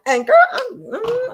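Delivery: sudden high loud yelp, slowed; excited burst. Real, not acted. Telling a listener how stories grip her, excited high yelp.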